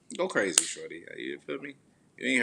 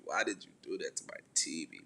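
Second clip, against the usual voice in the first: ordinary talk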